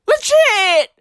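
high punchy legit; high excited affirmation; emphatic exclaim. Acted. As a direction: snap it out with conviction.

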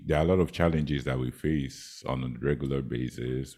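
ordinary talk

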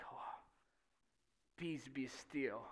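slow, low, unhurried command; calm command. Acted. Acts Jesus calming the storm.

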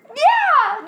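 high, loud burst; emphatic yeah. Real, not acted. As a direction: blurt it out with a laugh. Asked if she feels better.

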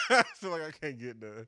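loud, high, words through real laughter; laughing. Real, not acted. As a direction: laugh helplessly at your own misfortune. Laughing at own bad luck.

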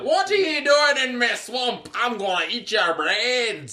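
high, slow, booming monster roar; booming ogre voice. Acted. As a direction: bellow it like a hungry ogre. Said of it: "this booming voice like".

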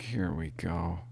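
soft, resigned mutter; quiet, resigned aside; resigned under breath. Retold or imitated. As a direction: sigh it out, knowing trouble is coming. Officer's resigned inner reaction.

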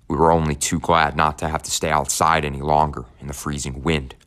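usual speaking voice

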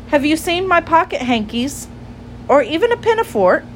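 high, hopeful questioning; storybook girl's polite question; little girl asking. Acted. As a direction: ask it high, like a child hunting for something. Lucy questions Jeremy Fisher.

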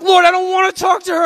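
high, loud mock tantrum; mad whining protest. Angry man refusing to approach the crying girl, hammed-up protest. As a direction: protest loudly like a balking child. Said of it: "I was so mad".

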